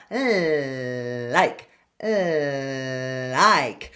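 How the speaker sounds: "Like" with a long low voiced L. Shown as a demonstration. Coach models the voiced L in like. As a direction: hum the L into "Like". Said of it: "a voiced L".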